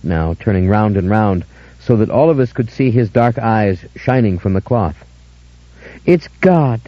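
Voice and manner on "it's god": higher, ringing cry of revelation; ecstatic scream. A narrator's reading. Dora seeing God's face, screaming. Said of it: "she screamed".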